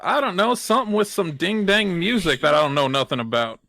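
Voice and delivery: easygoing cowboy knight; Southern twang. Acted. Knight chatting with a fellow knight, Southern twang. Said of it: "all of the knights are Southern now".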